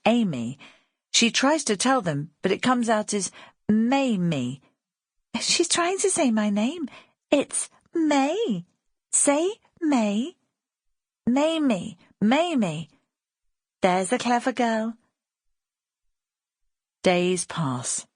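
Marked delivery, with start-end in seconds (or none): 5.4-15.0 put-on higher, coaxing girl's voice; young girl May. Girl May teaching the baby her name, high and coaxing. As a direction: coax the baby like a kind older girl. May's voice in the story.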